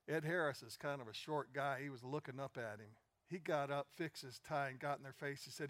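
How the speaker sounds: ordinary talk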